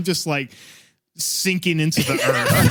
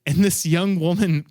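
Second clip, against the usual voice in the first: ordinary talk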